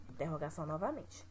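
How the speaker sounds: ordinary delivery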